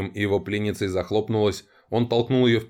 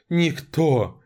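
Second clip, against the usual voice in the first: frightened cry; 6 semitones higher than usual. A narrator's reading. Tortured captive pleads.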